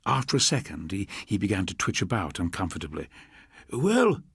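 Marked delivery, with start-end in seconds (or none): 3.7-4.2 high, tight nervous croak; a jittery addict croaking out one word. A narrator's reading. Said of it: "his voice a nervous croak".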